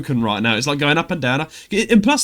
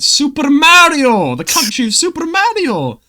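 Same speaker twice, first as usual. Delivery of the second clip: mock Italian accent; 12 semitones higher, 8 dB louder than usual. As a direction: ham it up like a video game plumber in an Italian accent. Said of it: "You should have done that accent".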